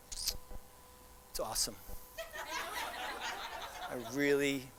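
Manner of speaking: low, soft, halting fake enthusiasm; unconvincing praise. Shown as a demonstration. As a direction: praise it with no conviction, pausing between phrases. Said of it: "I'm in trouble, right?".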